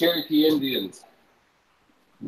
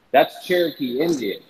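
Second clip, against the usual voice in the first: ordinary delivery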